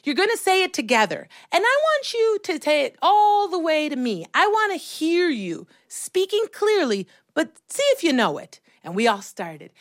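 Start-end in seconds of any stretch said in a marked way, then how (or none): 0.0-8.6 put-on higher-pitched children's drama teacher; encouraging, clearly projected instructions; instructing teacher. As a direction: coach the kids from across the room. Teacher instructing kids from back wall.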